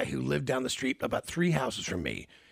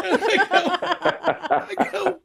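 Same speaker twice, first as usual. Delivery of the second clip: high, loud laughter spilling over the words; laughing hard; 4 dB louder, much slower than usual. Real, not acted. Retelling the mom's loyal reply to the host, laughing hard and high. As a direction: crack up, way up high.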